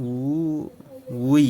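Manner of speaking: "উ, উই" with a drawn-out oo gliding into wee; slow teacher's demo of "উ, উই"; sounding out the syllable. Shown as a demonstration. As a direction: stretch "উ", then say "উই" as wee. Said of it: "আচ্ছা, এইভাবে উই".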